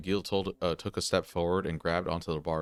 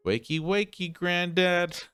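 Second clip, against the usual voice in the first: mocking yell; 9 semitones higher than usual. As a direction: yell it to jolt the old man awake. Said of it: "yelled at him".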